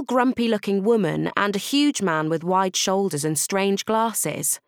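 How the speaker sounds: plain reading voice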